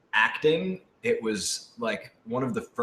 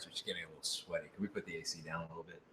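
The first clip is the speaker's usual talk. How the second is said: quick, much softer murmur to the crew; hushed aside; 5 semitones lower, 18 dB quieter, much faster than usual. Real, not acted. Talent quietly asks the crew to lower the AC, hushed and rushed. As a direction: rush it under your breath, apologetic. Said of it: "I'm sorry".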